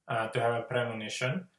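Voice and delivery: ordinary delivery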